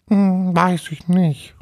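high, slow, hesitant ghost voice; unsure little ghost. Acted. Little ghost ponders its own name, high hesitant voice. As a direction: think aloud as a tiny ghost.

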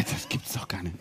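unvoiced, under the breath; a whispered aside of disbelief. Real, not acted. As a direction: mutter it to yourself, amazed. Disbelief at all four drawn.

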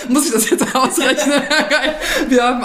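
laughing. Real, not acted. Laughing at the age question.

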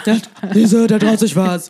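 loud, rowdy football-fan voice; bawling football fan. Pretend. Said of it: "sagst du das jetzt so im gröhlenden Ton".

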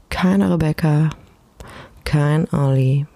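ordinary talk, nothing marked

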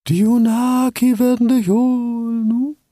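high, slow haunting owl; eerie owl voice. Acted. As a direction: intone it like a ghostly owl. Eerie owl speaks in dream.

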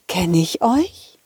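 usual speaking voice

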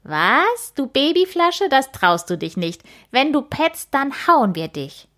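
taunting playground bully; mocking threat. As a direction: sneer and threaten the little one.

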